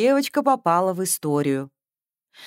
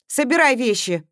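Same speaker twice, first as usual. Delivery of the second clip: commanding; 6 semitones higher, 5 dB louder than usual. A narrator's reading. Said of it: "распорядилась".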